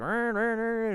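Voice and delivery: high-pitched mimicked engine whine; imitated engine revving. Coach showing too much throttle on a bike, imitated engine revs. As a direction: rev like an engine with your voice.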